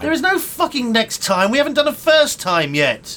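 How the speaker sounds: high, loud, furious protest; exasperated outburst. Acted. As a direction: erupt at the director's nonsense. Exploding at talk of next time.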